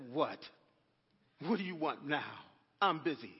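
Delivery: imitating an annoyed busy man; grumbled reply. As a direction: brush off God's call like he's interrupting. Preacher's grumbling reply to God.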